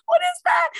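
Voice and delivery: high shrieking alarm; panicked shout. Retold or imitated. Owner seeing something in the dog's mouth, panicked high shout.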